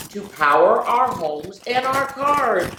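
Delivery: ordinary delivery, nothing marked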